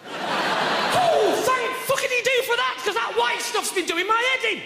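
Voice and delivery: high, loud mock gratitude; sarcastic outrage. Comic mocking the cereal ad, loud high sarcasm. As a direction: spit the thanks out with scorn.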